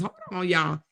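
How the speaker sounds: usual speaking voice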